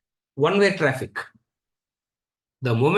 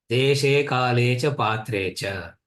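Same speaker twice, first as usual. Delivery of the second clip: low, slow chant of "Deshe kale cha patre cha"; slow scriptural quote; 5 semitones lower, much slower than usual. Shown as a demonstration. Teacher quoting the Gita line as a slow low chant. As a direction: intone the Gita line slowly. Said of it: "quoting Gita verse".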